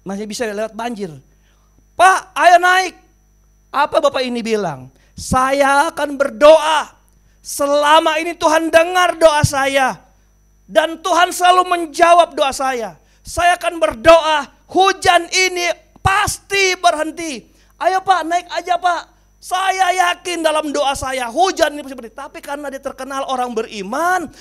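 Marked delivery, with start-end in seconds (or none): 2.0-2.9 loud urgent call. Acted. Village head calling to the old man from the jeep. 5.2-22.2 loud, slow, insistent faith; stubborn declaration. Acted. Old man refusing the village head, loud and stubborn.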